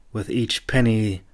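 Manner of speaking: ordinary delivery, nothing marked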